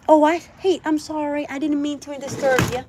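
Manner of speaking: ordinary talk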